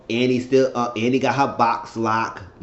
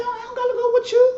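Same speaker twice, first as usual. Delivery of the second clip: squeaky mock brush-off, much higher; much faster than usual. Retelling a castmate's brush-off, squeaky mock voice. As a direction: toss it off in a high, fast, prissy mimic.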